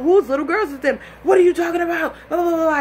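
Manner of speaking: high, loud back-and-forth argument; indignant mock shouting; retold flipping out. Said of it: "He's flipping out".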